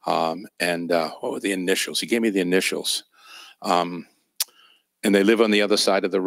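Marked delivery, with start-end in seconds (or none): none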